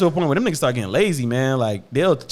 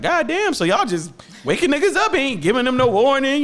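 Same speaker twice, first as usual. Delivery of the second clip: incredulous; 12 semitones higher, 3 dB louder than usual. Retold or imitated. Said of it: "I'm like".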